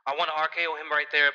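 normal talking voice

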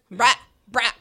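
"brat" for bro, with an open vowel and no O; demonstrating the Kendall "brat"; Kendall-style "brat". Shown as a demonstration. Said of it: "that's kind of like the Kendall brat".

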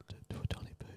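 unvoiced whisper. Real, not acted. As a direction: hush it to nothing. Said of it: "Yell it".

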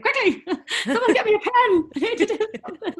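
frantic call for a pen; imitating her frantic self, laughing; laughing mock panic.

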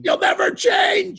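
very high, slow yell; hammed-up cry at an ex; mock outraged shout. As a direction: shriek it in comic despair.

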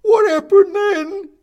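very high, loud shrieking voice; squeaky toy. A narrator's reading. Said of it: "says another".

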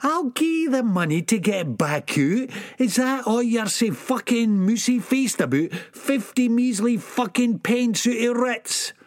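put-on ऊँची, चिढ़ी हुई आवाज़ में Sick Boy; तिरस्कार से ऊँचे सुर में ताना. Sick Boy's voice.

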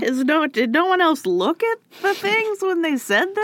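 incredulous. Real, not acted. Incredulous high question.

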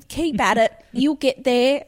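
mock-supportive, through a lipless smile; patronising encouragement. Nine-year-old encouraging Mum, patronisingly. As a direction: cheer her on condescendingly. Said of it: "the lipless smile".

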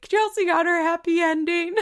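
high, loud laugh breaking into tears; laughing through tears. Real, not acted. A host moved by the happy ending, laughing tearfully. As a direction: crack up while welling up. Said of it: "look at me falling".

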